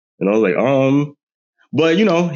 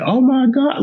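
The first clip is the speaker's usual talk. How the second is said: much higher mock shriek; retold scream; slower than usual. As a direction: shriek it like the news just hit. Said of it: "you screamed, like, loud".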